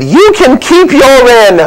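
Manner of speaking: high, drawn-out retort from an offended woman; annoyed Mary. Acted. Mary unhappy at the innkeeper's lack of options, annoyed. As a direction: snap back in a high mock female voice. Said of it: "She looked at me and said".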